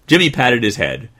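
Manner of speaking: ordinary delivery, nothing marked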